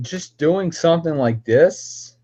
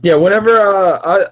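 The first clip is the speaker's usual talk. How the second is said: raised voice; 8 semitones higher, 7 dB louder than usual. Real, not acted. Calling to Skype guest.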